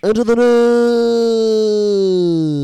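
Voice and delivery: very high, loud, slow stretched shout; Keemstar meme. Retold or imitated. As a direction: drag out the catchphrase loud. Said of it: "the meme that he sparked?".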